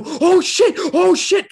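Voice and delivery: high, loud excited shout; excited yelp. Retold or imitated. Fan yelling as the [name] song starts. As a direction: yell it like the beat just hit. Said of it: "and I go".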